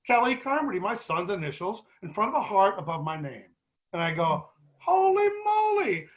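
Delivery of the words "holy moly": much higher and slow, stretched out in wonder; amazed exclamation. Retold or imitated. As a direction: gasp it out like you can't believe your eyes. Finding his name carved there.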